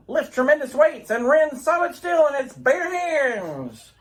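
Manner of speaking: grand announcer read of the Superman opening; old radio announcer. Acted. Radio announcer presenting Superman to boys and girls. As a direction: sell it like a vintage radio show.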